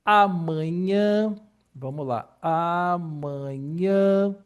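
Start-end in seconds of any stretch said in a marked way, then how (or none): 0.0-1.4 amanhã drawn out slowly, nasal ã stretched; model nasal vowel. Shown as a demonstration. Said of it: "A gente tem aí de". 2.4-4.4 amanhã drawn out slowly, nasal ã stretched; model nasal vowel. Shown as a demonstration. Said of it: "A gente tem aí de".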